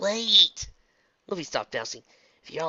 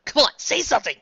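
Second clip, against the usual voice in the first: urgent; 12 semitones higher, 9 dB louder than usual. Acted. Dash urgent.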